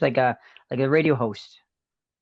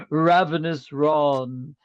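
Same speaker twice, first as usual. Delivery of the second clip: spooky host voice; much slower than usual. Acted. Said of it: "I'm your host".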